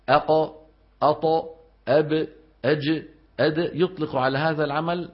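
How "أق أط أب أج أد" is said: أق، أط، أب، أج، أد with a crisp rebound on each final consonant; qalqala letter drill. Shown as a demonstration. Teacher drilling students on the qalqala letters, bouncing each stop. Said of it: "أن يطلقوا على هذا العمل".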